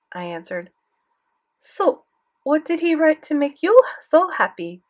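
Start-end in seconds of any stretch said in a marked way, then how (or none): 1.6-4.8 high, loud curious French granny; French grandmother, curious; French accent. Acted. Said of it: "thick French accent".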